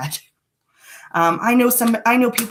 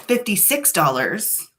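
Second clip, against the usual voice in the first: ordinary delivery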